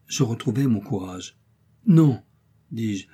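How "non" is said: defiant outcry. Acted. Heroine defies the uncle.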